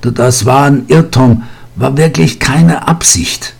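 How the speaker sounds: hastig stockend, etwas höher; stotternd beteuern. A narrator's reading. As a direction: verzweifelt stammelnd beteuern. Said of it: "stotterte Vincent". (stockende Beteuerung)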